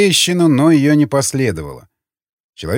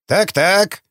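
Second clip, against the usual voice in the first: high squeaky grunting little man; 5 semitones higher than usual. A narrator's reading. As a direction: squeak it out like a rubbery toy. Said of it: "прокряхтел он писклявым голосом". (писклявым кряхтящим голосом)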